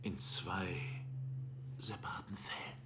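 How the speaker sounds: sudden hushed whisper for effect. Acted. Detective whispering a threat to the suspect. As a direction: drop to a whisper to unsettle him.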